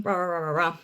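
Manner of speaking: droning blah-blah filler; dismissive filler. Retold or imitated. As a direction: rattle off nonsense syllables to wave it away. Trails quote off as filler noise.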